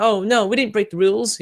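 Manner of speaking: high put-on voice, sneering; mocking whiny excuse. Mocking the moderators' excuse in a high whiny voice. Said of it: "they just said that".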